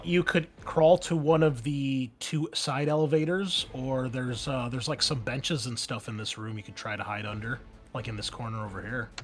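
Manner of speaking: normal talking voice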